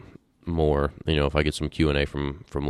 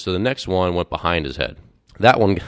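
normal talking voice

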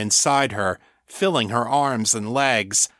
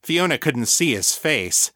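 ordinary delivery, nothing marked

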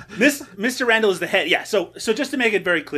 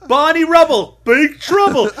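high, loud mock Cockney; Cockney accent; 11 semitones higher, 12 dB louder than usual. Cracking up co-host with rhyming slang, loud Cockney accent. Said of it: "no fucking Cockney rhyming slang".

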